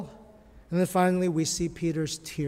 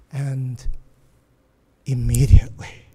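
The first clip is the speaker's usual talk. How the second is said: lower, hushed whisper; 5 semitones lower, 4 dB louder than usual. Acted. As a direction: hush it for the dramatic moment. Dramatic retelling of the crow.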